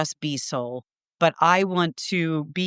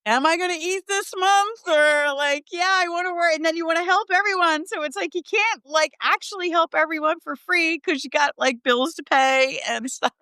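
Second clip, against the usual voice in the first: much higher, anxious put-on voice throughout; mock inner voice; 11 semitones higher than usual. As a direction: squeak it out like a worried broke healer. Mock voice of struggling healer.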